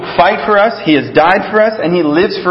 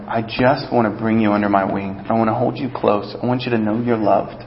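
soft, lower, tender promise; softer, lower, loving reassurance; tender God voice; 7 semitones lower, 6 dB quieter than usual. Acted. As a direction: hold them close with your voice, gently as God. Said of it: "God will always be there going".

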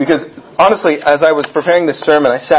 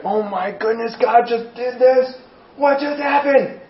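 amazed; 7 semitones higher than usual. Retold or imitated. Church amazed at miracle.

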